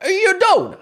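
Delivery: high, loud comic proclamation; mock verdict. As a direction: belt out the absurd punchline up high. Mock diagnosis repeated as punchline.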